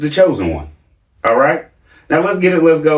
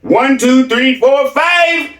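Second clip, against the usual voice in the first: mock child counting, higher; baby-talk counting; 10 semitones higher, much slower than usual. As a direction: count like a bragging little kid, pitched up. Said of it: "But as in baby talk".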